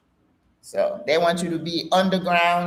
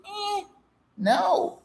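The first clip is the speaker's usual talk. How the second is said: dismissive no; 3 dB quieter than usual. Retold or imitated. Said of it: "to say".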